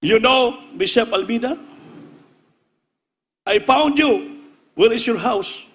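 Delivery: eager American visitor. Retold or imitated. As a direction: blurt it out like the excited American who found him. Retold American visitor finding pastor.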